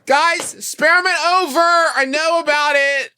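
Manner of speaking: high, loud public announcement; announcing loudly. Pretend. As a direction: proclaim it to the whole room. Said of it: "announcing loudly".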